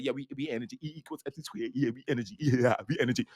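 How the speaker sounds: low, muted mock agreement; casually parroting it under the breath; offhand agreement. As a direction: imitate people nodding along, softly and low. Mimics believers casually accepting energy.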